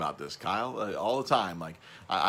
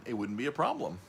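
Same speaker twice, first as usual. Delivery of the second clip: usual speaking voice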